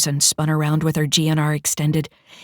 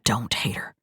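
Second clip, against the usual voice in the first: whisper; 6 semitones lower, 6 dB quieter than usual. Acted. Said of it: "Chase replied".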